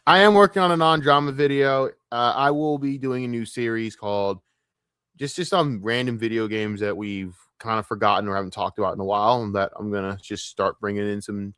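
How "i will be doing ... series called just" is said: ordinary talk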